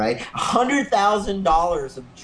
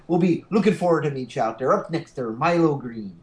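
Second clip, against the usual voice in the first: mock Irish accent of a company boss; hammed-up Irish accent, introducing a band; terrible Irish accent. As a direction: put on a bad Irish brogue.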